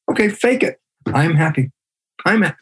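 high-pitched forced cheer; faking happiness. Acted. Lecturer acts out faking it while unhappy, high forced cheer. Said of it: "You could be a very unhappy person every day".

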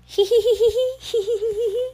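high rapid "hee hee" giggle; high, tittering puppy giggle; creepy giggle. A narrator's reading. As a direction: giggle like a mischievous wind-up toy. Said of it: "some creepy giggle".

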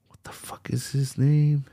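softly whispered while searching; whispered to self. Real, not acted. Streamer searching for a name, whispering to himself. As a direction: murmur it to yourself, distracted.

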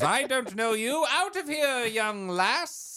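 high, indignant mock outburst; disowning father. Acting out his angry father.

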